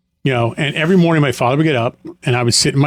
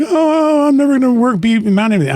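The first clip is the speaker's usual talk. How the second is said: high, strained voice of someone sure they'll never amount to anything; despairing self-doubt; 10 semitones higher than usual. Retold or imitated. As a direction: wail it like you've given up on yourself. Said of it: "you're like".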